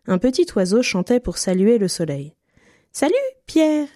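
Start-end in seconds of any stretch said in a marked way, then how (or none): 2.9-3.9 high piping little bird voice; chirpy bird. A narrator's reading. Little bird greeting Pierre on his branch, high chirpy voice. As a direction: chirp it like a small bird, higher. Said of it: "lui siffla-t-il".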